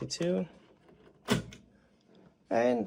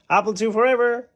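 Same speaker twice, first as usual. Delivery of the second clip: loud, high, fast rallying cry; cheering shout; 7 semitones higher, 10 dB louder, faster than usual. Real, not acted. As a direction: shout it out like a fan chant.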